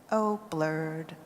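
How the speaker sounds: usual speaking voice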